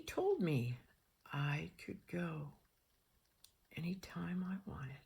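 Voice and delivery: slow, very soft, near whisper; quietly measured, word by word; hushed emphasis. Real, not acted. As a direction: drop to a slow, deliberate hush. Hushed emphatic aside in story.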